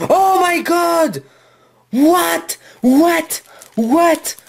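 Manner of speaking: high, shrieking disbelief at the card; excited shrieks. Real, not acted. Opening a rare card for viewers, high excited shriek. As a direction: squeal it out, stunned and thrilled. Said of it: "excusez-moi d'avoir crié les amis".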